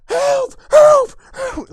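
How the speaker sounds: very high, full-volume screams; desperate high shrieks for rescue; yelling for help. Acted. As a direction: scream bloody murder. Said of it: "he's, like, yelling bloody".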